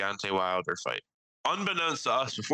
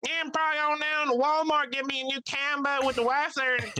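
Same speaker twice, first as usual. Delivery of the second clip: much higher imitated country voice; Mike's voice. Telling the fishing plan as Mike, high country voice. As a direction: do the toothless country friend. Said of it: "He's like".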